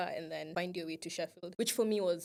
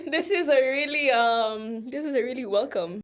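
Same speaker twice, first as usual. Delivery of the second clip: sarcastic welcome; 5 semitones higher, 11 dB louder than usual. Retold or imitated. Sarcastic thanks to the UK.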